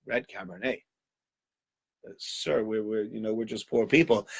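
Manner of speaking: very soft, hesitant reply; hushed and faltering; cowed host. Acted. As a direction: answer the duke timidly, barely audible. Cowed host answers the duke.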